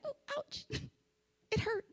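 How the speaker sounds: tiny high soft voice with a little laugh; underplay the pain, dainty and hushed; squeaky little pretend whimper; mock meek ouch. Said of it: "I'm not like".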